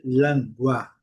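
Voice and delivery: "lingua" with a full "gwa" as in Portuguese; wrong model "lingua", open A after GW; mistaken Portuguese-influenced reading. Shown as a demonstration. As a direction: say "lingua" as lan-GWA, the learner's slip. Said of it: "a tendência é dizer".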